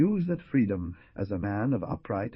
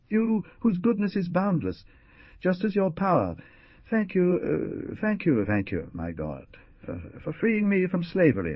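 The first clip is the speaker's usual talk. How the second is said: ecstatic prayer; 9 semitones higher than usual. Acted. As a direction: pour out boundless happiness to heaven. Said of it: "exclaimed with an accent of inexhaustible happiness".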